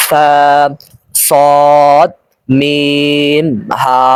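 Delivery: each of "ফা, সদ, মিম, হা" held long; drawn-out letter recitation. Shown as a demonstration. Teacher modelling the first line, "ফা, সদ, মিম, হা" drawn out. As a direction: stretch every vowel slowly, "সদ" and "মিম" especially. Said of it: "টান সহকারে আয়ত্ত করতে হবে".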